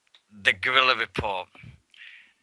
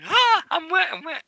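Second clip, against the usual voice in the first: very high, squealing mock dismay; high-pitched girlish cry, acted out; imitated little girl. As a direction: mimic a soaked little girl's wail. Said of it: "and she's going".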